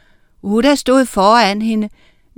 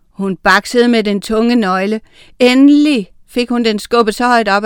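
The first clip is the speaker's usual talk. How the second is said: usual speaking voice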